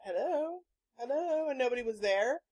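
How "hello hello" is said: tentative mock phone answer, rising higher on repeats; questioning hello. Retelling answering the unknown caller, questioning hellos. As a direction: call into a silent phone line. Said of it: "and was all".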